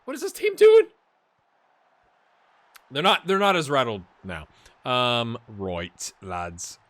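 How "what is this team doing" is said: high, loud bewildered mock cry; loud high mock panic; mock confused rival. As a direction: play the baffled opponents. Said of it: "they're like".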